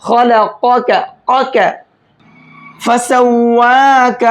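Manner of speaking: ख़लक़क broken up, then फ़सव्वाक chanted slowly; tajweed practice; practised recitation. Shown as a demonstration. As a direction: drill the qafs, then stretch फ़सव्वाक. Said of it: "कैसे पढ़ेंगे".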